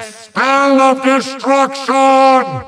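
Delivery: slow, high, drawn-out spell cry; grand incantation. Acted. Villain summons undead spell on the hero, grand slow incantation. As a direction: proclaim it with evil grandeur.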